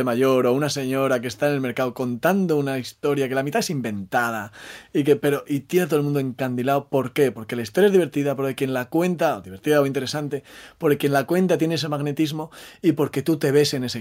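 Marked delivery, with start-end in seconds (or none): none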